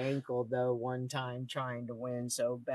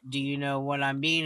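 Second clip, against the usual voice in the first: usual speaking voice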